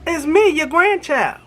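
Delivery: lightly voiced pleading teen; teenager in need. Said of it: "sound like a teenager in need".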